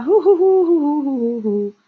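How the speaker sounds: string of high hoots falling away; high hooting. Real, not acted. Filling time on the livestream, high falling hoots. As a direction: hoot high, then slide down to normal. Playful hooting while reading chat.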